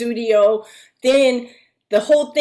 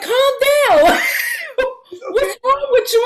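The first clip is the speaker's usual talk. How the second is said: laughing mimicry; 11 semitones higher than usual. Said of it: "but he was like".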